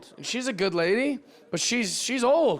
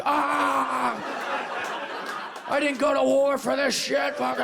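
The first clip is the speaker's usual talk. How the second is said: furious grandpa outburst; 8 semitones higher than usual. Acted. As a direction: yell like an outraged old veteran on a rampage. Acted enraged grandpa as mall shooter.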